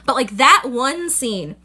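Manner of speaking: ordinary talk, nothing marked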